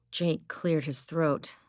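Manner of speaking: plain reading voice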